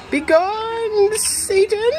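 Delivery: high, playful command to the devil; mock exorcist. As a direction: banish the cat like a demon.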